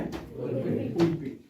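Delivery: Pulpit drawn out slowly and softly; slow demo. Shown as a demonstration. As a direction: stretch out pulpit gently. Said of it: "बोलो pulpit". (धीरे खींचकर pulpit)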